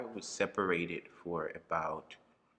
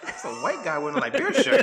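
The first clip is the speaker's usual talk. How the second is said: retold thought, laughing, much higher and louder; 15 dB louder than usual. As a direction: laugh it out, high and loud. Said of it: "I was like".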